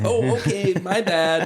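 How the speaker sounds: a quick embarrassed oops; sheepish wife. Retold or imitated. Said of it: "And she's like".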